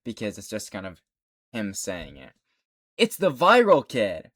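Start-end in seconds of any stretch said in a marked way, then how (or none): 3.0-4.3 quoting the line. Retold or imitated. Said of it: "That's him pointing out".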